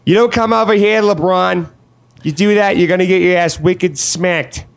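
high-pitched Boston tough; mock Boston accent. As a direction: ham up a Bostonian warning off a player. Mock Boston racist threatening LeBron.

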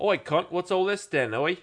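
high, slow British challenge; hammed-up British "oi"; mock British accent. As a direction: draw it out in a put-on British accent. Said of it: "craft the most British sentence".

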